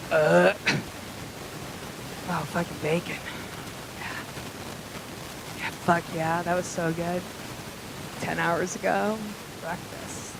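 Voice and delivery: low, soft, blissful reminiscence; hushed, halting relish after a burp; quiet savouring. Acted. Eater tasting bacon burp hours later, soft savouring.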